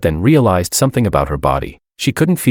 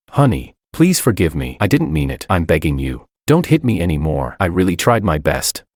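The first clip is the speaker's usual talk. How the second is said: ordinary delivery, nothing marked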